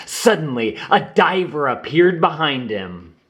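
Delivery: ordinary delivery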